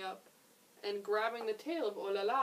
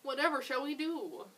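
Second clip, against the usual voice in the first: desperate; 6 semitones higher, 4 dB louder than usual. A narrator's reading. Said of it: "Miss Poodle cried out in desperation".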